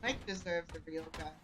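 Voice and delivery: ordinary talk, nothing marked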